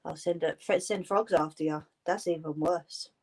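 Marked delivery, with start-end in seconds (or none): none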